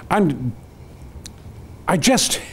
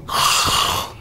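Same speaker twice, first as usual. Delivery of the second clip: big audible rush of breath; imitated breath. Shown as a demonstration. Storyteller showing the universe sucked back in, big breath. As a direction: act out a huge cosmic breath.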